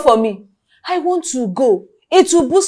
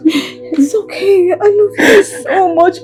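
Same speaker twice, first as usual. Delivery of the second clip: tearful comfort; much slower than usual. Acted. Sister comforts sibling, tearful.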